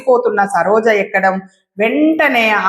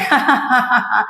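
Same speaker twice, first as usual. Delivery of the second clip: ticklish laugh. Acted.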